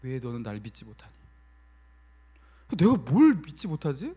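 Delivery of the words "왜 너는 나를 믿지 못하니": low, hushed voice of God; God speaking gently. Retold or imitated. God repeats the question to the pastor, low quiet reproach. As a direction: drop right down into a quiet, grave question. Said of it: "그런데 하나님께서".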